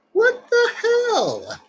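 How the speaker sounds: very high, slow falling squeak; squeaky disbelief. Real, not acted. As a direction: stretch it out in a squeaky falsetto. Shocked by creepy smile.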